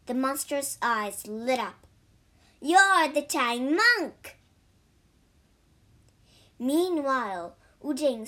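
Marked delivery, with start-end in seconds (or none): 2.6-4.4 loud, slow triumphant cry; gleeful monster. A narrator's reading. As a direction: savour the discovery, loudly. Monster recognises his meal.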